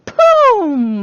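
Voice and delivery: loud, high, drawn-out "boom"; big storytelling sound effect; explosive boom. Retold or imitated. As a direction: hit it like a cartoon impact. Acts out the cub's kick.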